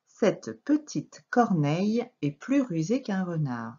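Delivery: "Cette petite corneille" joined up in one measured flow; careful, fluent rereading of "Cette petite corneille"; connected reading. Shown as a demonstration. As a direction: read through to "renard" without breaks. Same sentence reread in connected flow.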